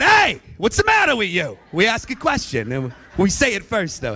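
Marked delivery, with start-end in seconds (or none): 0.0-1.6 angry Italian shout; mad hey. Retold or imitated. East Coast Italian mad hey, shouted at someone. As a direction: snap at them with a mad hey. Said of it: "When we're mad".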